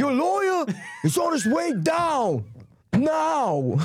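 high, slow hammed-up yell; mock cop shout. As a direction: drag out the call to the hostage. Acting movie police lines.

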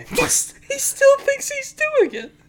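high, laughing voice; laughing while talking. Real, not acted. Friend mocking a buddy's yawn while laughing. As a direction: talk through giggles.

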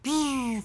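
high thin buzzing rocket whizz. Retold or imitated. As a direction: imitate a rocket flying past, high and soft. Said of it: "that's where the fucking rocket sound".

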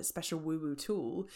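ordinary delivery

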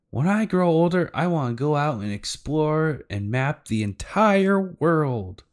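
put-on high, eager little-kid voice; dreaming child. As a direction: imitate a child declaring a big dream, slowing grandly. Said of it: "saying her dream".

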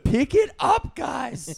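high-pitched mock command; mock barked order. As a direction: order them to pick up the pace. Mock ordering the others about.

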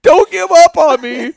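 drawn-out, high, loud imitation of pleading; mock crying plea. Intruder begging the homeowner not to abandon him, mock tearful. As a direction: wail it slowly as a mocking imitation. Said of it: "he starts crying".

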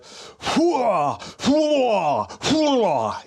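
high, loud army war cry; loud gung-ho soldier. Pretend. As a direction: bellow it like a pumped-up soldier. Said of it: "Let me warm up a little bit".

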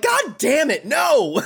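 high-pitched exasperated outburst; mock exasperation. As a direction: wail in protest at a bad joke. Acted exasperation at the pun.

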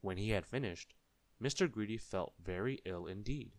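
usual speaking voice

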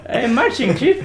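mild mock recruit reply; innocent recruit. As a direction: answer innocently, unaware. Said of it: "he's like".

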